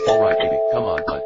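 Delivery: loud yell of encouragement; urging shout. Acted. Firefighter urging his buddy on, loud shout. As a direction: yell to spur a partner on.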